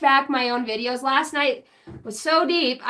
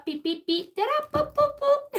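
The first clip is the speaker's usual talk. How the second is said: high, soft robot beeps; silly beep boops; 12 semitones higher, 5 dB quieter than usual. Pretend. Goofing off for viewers, chirping high robot beeps.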